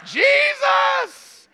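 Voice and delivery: high, loud, ecstatic shout; joyful cry of recognition. Acted. Mary crying out joyfully on recognizing Jesus. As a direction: burst out in overjoyed disbelief.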